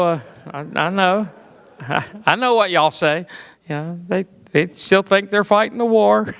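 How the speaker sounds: ordinary talk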